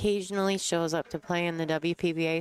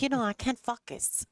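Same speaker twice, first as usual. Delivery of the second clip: higher-pitched imitated French Canadian accent; 10 semitones higher than usual. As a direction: mimic a French Canadian whose "focus" sounds like a swear word. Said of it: "say it in the French Canadian way".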